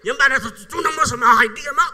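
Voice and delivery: loud scolding questions, rising in fury; mother scolding. Retold or imitated. Strict Waray mother scolding her late child, loud.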